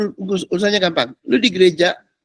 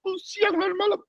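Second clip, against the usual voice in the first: whiny believer; 6 dB quieter than usual. Retold or imitated. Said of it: "Otaknya belum".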